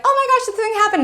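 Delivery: a friend bursting with good news; excited news. Retold or imitated. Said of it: "be like".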